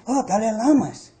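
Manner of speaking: ordinary talk, nothing marked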